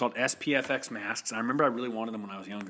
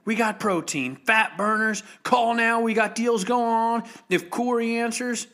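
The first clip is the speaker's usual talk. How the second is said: hammed-up loud, high ad shout; shouty mock gym commercial, slowing to the punchline; local commercial pitchman; 10 semitones higher, 6 dB louder, slower than usual. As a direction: sell it hard like a cheap TV spot. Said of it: "make a Fit Republic commercial like that".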